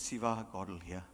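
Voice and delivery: low solemn warning. Retold or imitated.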